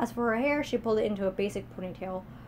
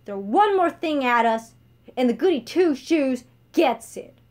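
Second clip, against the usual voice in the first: louder and slowed warning; menacing threat; 4 semitones higher, 9 dB louder than usual. Acted. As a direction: threaten him slowly. Beatrice threatens the Chancellor.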